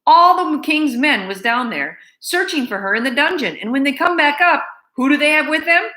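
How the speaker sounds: scornful housekeeper. Acted. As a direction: dismiss the girl with contempt. Louise scornful, raised voice.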